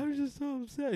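high-pitched mock wail; tearful upset kid. Caught kid pleads with the teacher, mock tearful. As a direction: whine it like a kid faking heartbreak.

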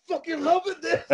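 breaking into high laughter; laughing. Real, not acted. Friend mocks the crowd's reaction, laughing high. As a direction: laugh through the words.

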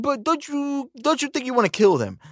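high, needling mock interviewer. Host badgering [name], in a high mocking whine.

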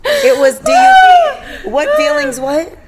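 high squealing laughter through words; can barely get the words out laughing. Real, not acted. Laughing fit while recalling question.

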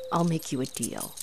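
normal talking voice

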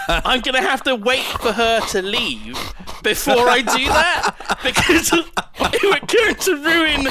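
high, loud, struggling through real laughter; laughing. Real, not acted. Guest retelling an awkward shower moment, laughing. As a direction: tell it while cracking up, pitch climbing.

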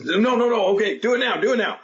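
high, urgent rushed cue. Retold or imitated. Retelling the go signal, urgent and high. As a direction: hurry him to fire now, mock urgency. Panicked cue to fire the gun.

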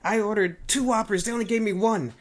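high, indignant mock customer voice; complaining Karen. Karen at Burger King short a Whopper, mock indignant complaint. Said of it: "you have a Karen that comes in".

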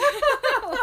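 sudden high laugh; loud burst of laughter. Real, not acted. Reaction to steamy passage.

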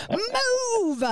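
high, strained cry of move; a frazzled parent begging the toddler to go; desperate plea. Retold or imitated. Frustrated parent urging toddler.

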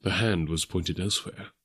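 ordinary delivery, nothing marked

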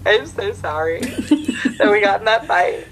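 mock emotional phone apology; imitated apologetic friend. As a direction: overdo a remorseful promise through laughter. Drunk friend's apology over the phone.

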